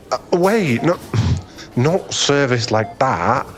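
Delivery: panicked backpedal, pitch jumping up; flustered backtracking. Acted. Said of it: "I'm so embarrassed".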